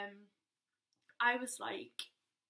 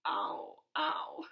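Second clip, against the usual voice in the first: a small crying ow; barely able to answer; pained whimper; 6 semitones lower, 6 dB louder than usual. Retold or imitated. To her sister, a weak crying ow. Can't respond, in pain.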